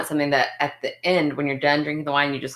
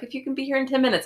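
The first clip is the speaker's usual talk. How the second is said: high, quick imitated receptionist; dental office caller; 8 semitones higher, faster than usual. Receptionist offering a late patient a chance, high quick imitation. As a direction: pitch it up and rattle it off. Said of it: "And she was like".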